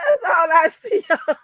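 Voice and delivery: words squeezed out through laughter; laughing. Real, not acted. Telling the audience what she sees, laughing loudly. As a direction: push the line out while cracking up.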